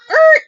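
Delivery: sudden loud high buzzer noise; mock buzzer. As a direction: cut them off with a blaring "errr". Cuts off her excuse.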